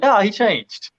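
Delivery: ordinary talk, nothing marked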